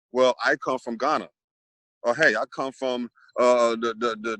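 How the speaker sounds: ordinary delivery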